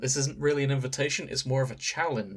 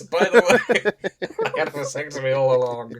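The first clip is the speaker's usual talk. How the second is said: mock twist reveal; 2 semitones higher, 8 dB louder than usual.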